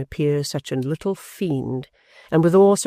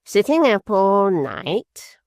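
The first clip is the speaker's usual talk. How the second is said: slow, higher, drawn-out mocking finish; smug dwarf; 6 semitones higher, 4 dB louder, much slower than usual. A narrator's reading. Quilp finishing his mother-in-law's sentence, slow and smug. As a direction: savour finishing her sentence. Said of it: "supplying the conclusion of the sentence".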